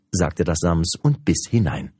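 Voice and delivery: plain reading voice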